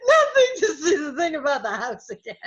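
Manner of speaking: imitated helpless laughing plea; high, loud, choked with mock laughter; laughing to tears. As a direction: act barely getting words out through laughter. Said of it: "tears rolling down his face".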